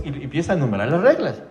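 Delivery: ordinary talk, nothing marked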